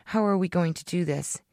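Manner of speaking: ordinary delivery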